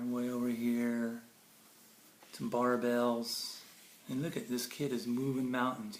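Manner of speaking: ordinary delivery, nothing marked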